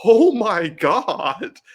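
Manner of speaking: reliving a horrified laugh at the screen; horrified gasp. Retold or imitated. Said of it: "I was laughing and horrified".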